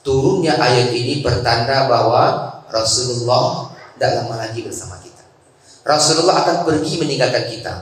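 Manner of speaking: grieving Abu Bakar. Retold or imitated. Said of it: "Abu Bakar dia menangis".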